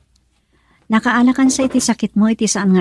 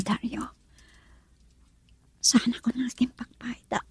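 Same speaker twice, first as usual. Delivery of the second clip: much softer, a breathy whisper; faint whisper; 11 dB quieter than usual. Acted. Dying woman whispering her fate to Dario. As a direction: fade into a hushed whisper.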